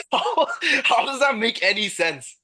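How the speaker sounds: high incredulous laugh through the words; real laughing bafflement, pitched well up; laughing disbelief. Real, not acted. Friend baffled by the claimed elevator rating, laughing in disbelief.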